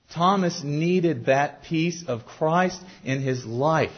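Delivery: ordinary talk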